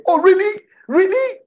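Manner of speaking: very high, loud feigned surprise; mock amazement. Jesus humouring his excited disciple, mock amazed. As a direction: play along with exaggerated astonishment.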